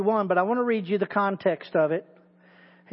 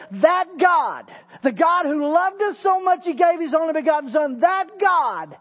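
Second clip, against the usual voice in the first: higher, louder rising praise of God; preaching at full force; 5 semitones higher, 3 dB louder than usual. Acted. Preacher lifting the congregation, full-force preaching. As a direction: build it up like a revival climax. Builds a sermon climax.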